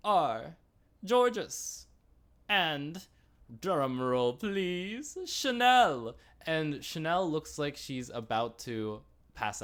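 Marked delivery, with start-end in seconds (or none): 0.1-6.2 high, drawn-out winner announcement; mock show-host reveal, stretching words for suspense. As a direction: imitate a host's grand reveal, building to a louder last name. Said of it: "and she says".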